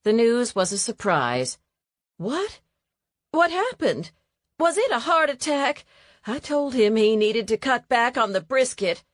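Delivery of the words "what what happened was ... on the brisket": higher, startled woman's voice; lightly voiced shocked neighbour; Evelyn voiced. Narrator voicing Evelyn's shock at the news, higher and startled.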